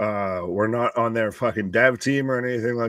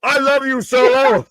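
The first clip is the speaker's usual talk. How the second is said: playful gushing; 11 semitones higher, 6 dB louder than usual. Pretend. Playful declaration, high pitch.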